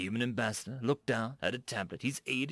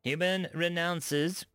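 smug and a bit higher, louder; 7 semitones higher, 5 dB louder than usual. A narrator's reading. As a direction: savor the point, self-satisfied. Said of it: "the Dak ambassador replied smugly".

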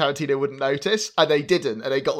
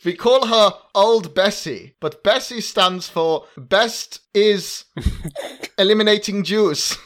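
mock Nazi farmer; 7 semitones higher, 5 dB louder, slower than usual. Joking Nazi farm voice.

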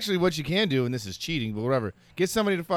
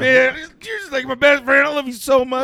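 put-on higher, louder sappy drunk friend; lovey-dovey drunk; 7 semitones higher, 5 dB louder than usual. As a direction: gush like a clingy drunk. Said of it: "It's always the lovey-dovey".

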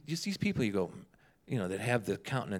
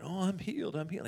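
mocking; 7 semitones higher than usual. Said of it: "he was kinda making fun".

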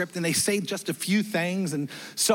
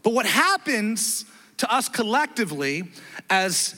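ordinary talk, nothing marked

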